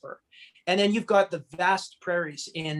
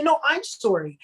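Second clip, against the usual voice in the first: insistent apology; 7 semitones higher than usual. Retold or imitated. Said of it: "And then you say".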